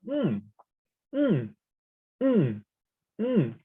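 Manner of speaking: "mm" starting high and dropping sharply; falling tone. Shown as a demonstration. Teacher as someone sure of an answer, falling tone. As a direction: drop "mm" from the top, decisively. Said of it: "So this is the falling tone".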